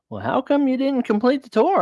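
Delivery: a prim lady asking, voiced high; high mock lady voice. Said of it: "she goes".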